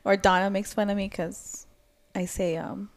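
usual speaking voice

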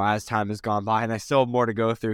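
ordinary talk

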